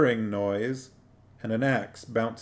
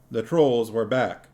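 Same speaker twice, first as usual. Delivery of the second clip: plain reading voice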